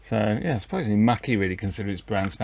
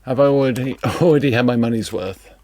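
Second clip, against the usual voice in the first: laughing; 7 dB louder, faster than usual. Real, not acted. Laughs at deck already failing.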